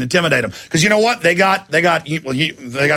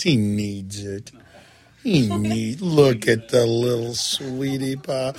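cooing at a dog; 5 semitones lower, 5 dB quieter than usual. Real, not acted. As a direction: coo over the little dog.

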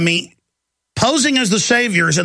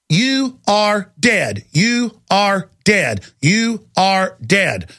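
very slow, every word spaced apart; slow, deliberate repetition; much slower than usual. Real, not acted. Host warning listeners, slowly spelling out the verdict. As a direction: drag each word out like a verdict. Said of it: "repeats slowly for emphasis".

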